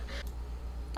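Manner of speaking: real laugh spilling out of the words; amused laughing. Real, not acted. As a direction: crack up at your own joke. Cracks up at own joke.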